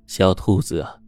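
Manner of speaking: usual speaking voice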